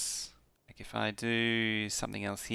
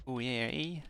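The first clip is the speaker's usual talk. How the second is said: put-on Canadian accent; 3 semitones higher than usual. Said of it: "Suddenly went Canadian there, eh?".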